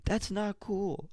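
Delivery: high, slow falsetto objection; mock childish protest. Retells child's protest to parents.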